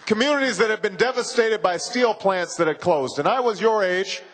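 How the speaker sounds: normal talking voice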